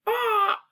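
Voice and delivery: high bird squawk; jay cry. Acted. Reader trying out what jays say, squawking. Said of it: "What do jays say?".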